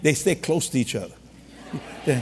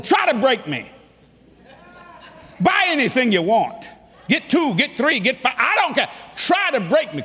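triumphant dare; 6 semitones higher than usual. Retold or imitated. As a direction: throw the dare out big. Preacher dares wife to spend.

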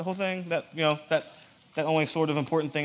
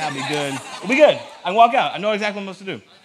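higher, much louder burst of confidence; mock eager churchgoer; 4 semitones higher, 8 dB louder than usual. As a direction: build into a loud, carefree 'problem solved'. Mimicking a congregant's wishful thought.

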